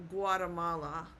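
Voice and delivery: Guatemala the English way, GWAH-tuh-MAH-luh; anglicised Guatemala with a weak middle vowel; English pronunciation. Shown as a demonstration. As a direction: say Guatemala as an English speaker would. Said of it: "In English, we s-, might say it".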